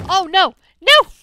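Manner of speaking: high, very loud shrieks of alarm; panicked scream. Real, not acted. Zombie attack startles him.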